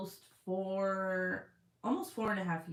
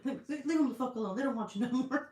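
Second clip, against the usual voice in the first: higher, sassy mock voice, laughing through it; mother joking the breasts want nothing more to do with him, sassy; mocking put-on voice; 6 semitones higher, 3 dB louder than usual. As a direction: scold the toddler in a cheeky higher voice. Voicing a mocking retort.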